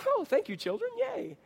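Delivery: high sing-song fake delight; mock cheerful. Dad mocking a cheerful wake-up, exaggerated sarcasm. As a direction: pretend to be thrilled, sarcastically. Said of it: "it's not like I pop out".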